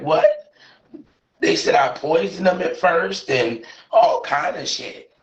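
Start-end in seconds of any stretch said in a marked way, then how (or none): 0.0-0.5 squeaked high disbelief; a stunned, sky-high what; shocked. Real, not acted. Woman hears she was accused of poisoning, shocked squeak.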